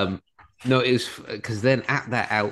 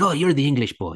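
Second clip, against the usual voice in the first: put-on higher-pitched Irish accent; Irish fella; 7 semitones higher, 4 dB louder than usual. Irish dad greeting the English parent at the match, Irish accent. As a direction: call it out as a retold Irish sideline dad. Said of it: "there was an Irish fella there".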